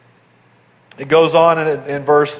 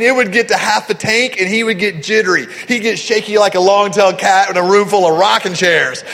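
ordinary talk

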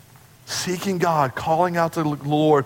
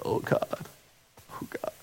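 hushed, broken whispered plea; desperate whisper; 12 dB quieter than usual. Retold or imitated. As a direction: whisper it like a humbled sinner. Sinner beating his chest praying.

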